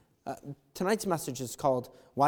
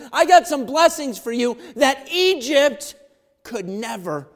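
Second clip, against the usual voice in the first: loud, high-pitched pulpit preaching; rising revival preacher; preaching at full force; 8 semitones higher, 13 dB louder than usual. Acted. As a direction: preach it out to the whole room. Preacher voicing God's promise loudly.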